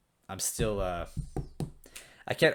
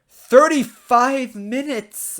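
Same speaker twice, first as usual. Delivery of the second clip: much higher, louder, slow disbelief; real astonished outburst; shocked exclamation; 14 semitones higher, 13 dB louder, much slower than usual. Real, not acted. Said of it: "how much time do I have".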